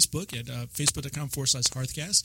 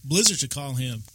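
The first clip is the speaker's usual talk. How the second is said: ordinary talk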